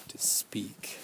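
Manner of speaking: whispered, almost voiceless. Real, not acted. Survivor whispering that speaking up is their duty. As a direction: let the last words drop to a breath.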